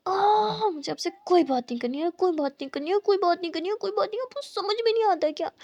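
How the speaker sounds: rising, sulky repeated refusal; a girl sulking at her teasing partner; childish huff. Acted. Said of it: "मुस्कान बोलती".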